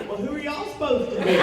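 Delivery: high, playful Texas twang. Retold or imitated. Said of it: "I put on my twangiest Texas accent".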